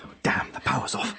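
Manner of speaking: tense whisper in the dark; frightened whisper; whispered curse. Acted. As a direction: whisper the curse under your breath. Hiding in the dark attic.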